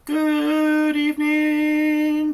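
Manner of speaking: high, slow robotic monotone; loud, held on one high note like a robot. Shown as a demonstration. Teacher shows kids a robot good evening, monotone. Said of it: "like a robot".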